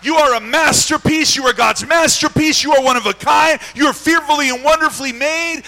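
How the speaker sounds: full-force preaching. Real, not acted. Preacher tells the church of their greatness, fervent preaching. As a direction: proclaim it to the whole congregation.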